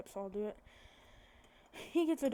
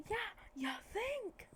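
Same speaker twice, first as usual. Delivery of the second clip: high-pitched, softer acted retort; incredulous sarcasm; 12 semitones higher, 5 dB quieter, slower than usual. Crowd's sarcastic reaction repeated.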